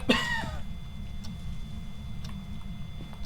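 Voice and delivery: high breathy nervous laugh. Real, not acted. Nervous laugh after scare.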